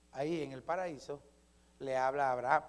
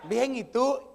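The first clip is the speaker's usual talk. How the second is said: lightly imitated woman, higher and louder; half-attempt a sweetheart's higher voice; girlfriend's reply; 6 semitones higher, 7 dB louder, slower than usual. Sister answering her boyfriend, lightly imitated higher voice. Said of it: "no la puedo hacer yo".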